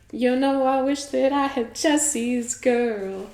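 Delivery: lightly voiced spooky killer singing; spooky little voice. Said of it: "his, like, spooky little voice".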